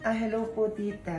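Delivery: normal talking voice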